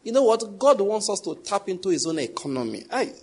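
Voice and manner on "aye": ordinary talk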